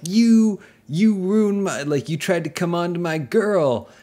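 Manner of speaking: loud high greaser confrontation, hammed; angry greaser. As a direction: yell it like a jealous greaser.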